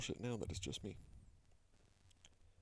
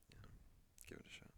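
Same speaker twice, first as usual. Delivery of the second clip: whisper; 16 dB quieter, much faster than usual. Real, not acted. Whispered aside.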